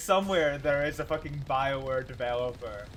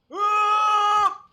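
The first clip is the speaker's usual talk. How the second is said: high, loud, stretched-out curse; angry shout; 11 dB louder, much slower than usual. Real, not acted. Gamer killed by an enemy, angry shout. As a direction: yell the curse in frustration.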